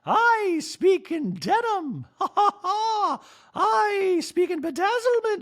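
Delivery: high, slow droid with laughs; prissy robot with a giggle; Dorit robot voice. Retold or imitated. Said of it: "I do that voice for Dorit".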